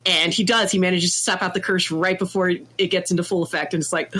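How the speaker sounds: usual speaking voice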